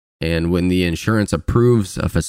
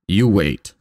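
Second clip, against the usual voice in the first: put-on Russian accent. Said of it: "Was that in her best Russian accent?".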